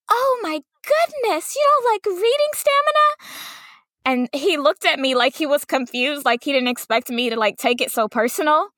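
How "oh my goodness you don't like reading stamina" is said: much higher, sing-song kindergarten teacher; sing-songy teacher voice. Retold or imitated. Kindergarten teacher to a student, sing-song and much higher. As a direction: lift the voice way up and sing it, wounded. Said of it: "raises their voice in a sing-songy way".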